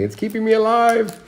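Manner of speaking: hammed-up cry for help; mock desperation. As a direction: squeak it out like a man barely hanging on. Mock-desperate joke about the movie.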